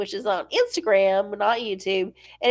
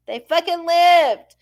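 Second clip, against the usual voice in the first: slow, loud and higher, each word hammered; emphatic; 7 semitones higher, 6 dB louder than usual. Real, not acted. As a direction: punch every word of it home. Emphatic point about surviving kids.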